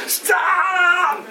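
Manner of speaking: high, shouted "Stop!" at the clock; pretend shout. Preacher acting out shouting at a clock to stop. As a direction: yell at time to halt, arms out.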